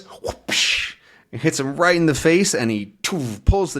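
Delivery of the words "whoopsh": unvoiced imitated whoosh; rake swing noise. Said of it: "and it goes".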